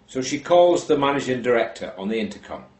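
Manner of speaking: usual speaking voice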